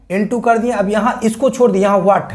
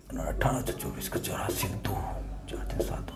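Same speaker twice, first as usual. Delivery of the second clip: barely audible whispered arithmetic; muttering calculation; 12 semitones lower, 18 dB quieter than usual. Real, not acted. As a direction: whisper the sum to yourself as you work. Works out sum to himself.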